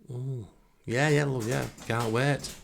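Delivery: mock-enthusiastic, dripping sarcasm; sarcastic. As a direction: fake a keen reply. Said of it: "You're going to love the next rehearsal".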